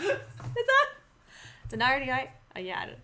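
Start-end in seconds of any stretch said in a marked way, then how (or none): 1.7-2.3 hesitant try at Northern Irish; unsure Northern Irish, broken off; Northern Irish accent attempt. Shown as a demonstration. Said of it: "Irish accent".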